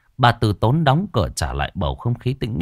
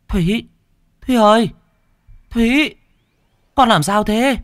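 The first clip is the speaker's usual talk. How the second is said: worried mother; 13 semitones higher, 4 dB louder than usual. Acted. Mother waking crying daughter.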